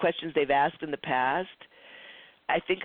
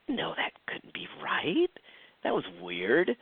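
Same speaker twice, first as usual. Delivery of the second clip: hushed, disbelieving self-talk; whispered doubt. Retold or imitated. Telling students how she doubted it, she whispers. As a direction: whisper it to yourself, unsure. Doubting herself under her breath.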